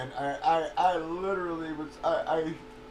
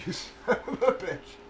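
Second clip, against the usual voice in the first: amused, rueful swearing; laughing curse. Retold or imitated. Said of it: "and I was just like".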